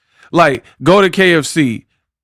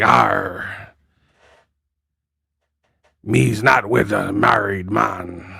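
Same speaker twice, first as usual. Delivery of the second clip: low, slow, softer mock pirate; Clarissa Shields; 5 semitones lower, 5 dB quieter, slower than usual. Boxer denying an affair, mocked as a pirate. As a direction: deny it in a hammed-up pirate growl. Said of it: "she be like".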